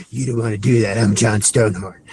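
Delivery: low and menacing, naming himself as the threat; tough-guy threat. Retold or imitated. Hero warning the jail bully, low and menacing. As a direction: growl a calm warning from low in the chest. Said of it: "And he's like".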